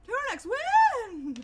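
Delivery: very high, loud, drawn-out "when?"; startled shrill shout; sudden loud high demand. Real, not acted. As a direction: bellow the question slowly and shrilly. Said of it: "I did not mean to say it like that".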